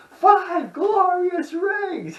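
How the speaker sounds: very high, loud and slow drawn-out howl; stretched, shrieking mock cry; Kevin's bellow. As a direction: belt it out like a deranged holiday carol. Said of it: "Kevin's like".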